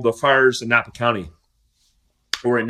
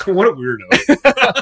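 laughing; 7 semitones higher, 6 dB louder, much slower than usual. Real, not acted. Teasing host over gas habit.

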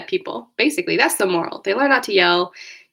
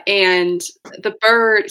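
usual speaking voice

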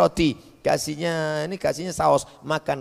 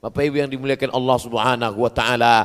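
ordinary talk

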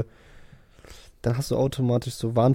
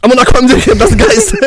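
very high, loud mock-scared cry; gespielte Panik; 10 semitones higher, 11 dB louder than usual. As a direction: squeal in hammed-up fright. Acts frightened sighting of a ghost. (gespielt panischer, hoher Schrei)

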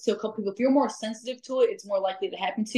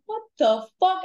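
startled exclamation; 10 semitones higher, 3 dB louder than usual. Retold or imitated. Retells hearing a scream.

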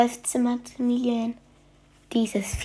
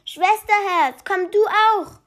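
excited calling; 10 semitones higher than usual. Acted. The boy calls his mother to come see, high and excited. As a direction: call her over, bursting with news.

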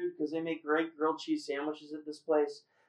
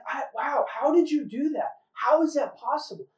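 higher-pitched, mildly freaked-out retelling; retold amazement; 7 semitones higher than usual. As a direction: imitate her astonished questions, pitched up. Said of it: "she really is, like, freaking out".

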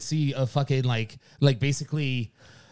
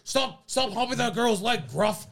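higher, mock scolding call to a dog; gruff dog-owner voice; 8 semitones higher than usual. Scolding his imagined dog Gruff, mock gruff command. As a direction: pretend to order a misbehaving dog about. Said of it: "Why do you sound like that".

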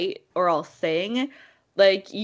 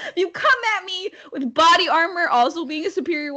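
high-pitched threat through laughter; laughing mock threat; 9 semitones higher, 4 dB louder than usual. Real, not acted. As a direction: try to threaten while cracking up. Retries threat while laughing at own voice.

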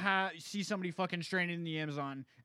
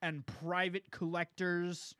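usual speaking voice

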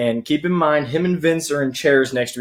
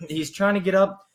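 usual speaking voice

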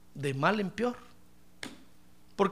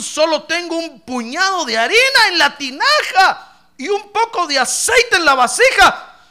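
loud, higher, anguished complaint; bitter widow; 5 semitones higher, 11 dB louder than usual. Retold or imitated. Preacher voicing the widow's bitter reply, loud and anguished. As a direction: pour out her bitter lament. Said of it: "Hubiera matado a Elías".

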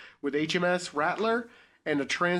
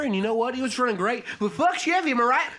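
country accent; 8 semitones higher, 4 dB louder than usual. Shown as a demonstration. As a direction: brag about the truck in a twang. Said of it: "a country accent".

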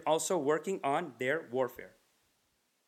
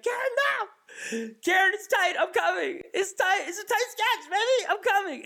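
hammed-up war-movie rescue yell; very high, loud mock desperation; panicked shouting; 5 dB louder than usual. Acted battlefield rescue of colleague.

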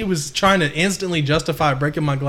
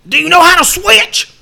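much higher and louder mock coach voice; coach impression; 14 semitones higher, 9 dB louder than usual. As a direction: ham up the goofy coach. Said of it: "He said".

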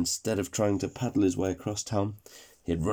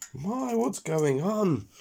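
puzzled character voice; 10 semitones higher, much slower than usual. Acted. Speedy Burger wondering in the story.

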